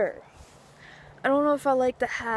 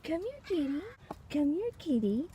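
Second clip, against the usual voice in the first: high, sweet coaxing call to a cat; 7 semitones higher, 8 dB quieter than usual. Real, not acted. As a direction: lure the kitty over with a high sing-song.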